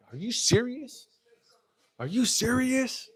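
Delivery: high, incredulous mock outrage; imitating a furious double take; disbelieving outrage. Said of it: "I was fucking mad".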